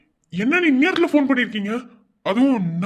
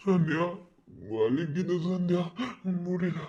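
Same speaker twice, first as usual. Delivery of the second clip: lower, softer, fading pained voice; weak with pain; 4 semitones lower, 9 dB quieter than usual. Acted. Wounded husband fading as he calls his wife. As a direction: let the strength drain out word by word.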